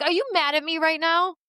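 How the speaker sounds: a hint of wounded disbelief, high voice; hurt coworker. Imitating coworker asking if she is mad, mildly hurt.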